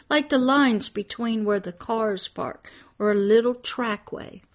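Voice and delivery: normal talking voice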